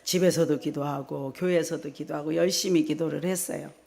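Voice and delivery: usual speaking voice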